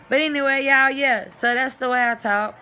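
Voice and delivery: high and loud, bursting out; excited. Real, not acted. Wrapping up her gibberish demo for viewers, excited. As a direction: burst back in, thrilled.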